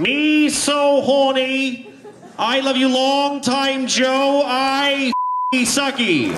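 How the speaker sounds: high, slow broken English come-on; Boston Kennedy drawl meets street hooker; JFK as Saigon prostitute. Acted. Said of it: "John F. Kennedy is a prostitute in Saigon".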